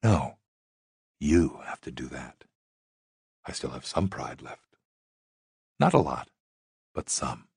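a hint of a proud, wry stripper; lightly voiced sultry woman. A narrator's reading. Sammy voiced by narrator.

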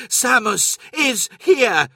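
high, slow, ecstatic cry; cried in delight. A narrator's reading. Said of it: "he cried in delight".

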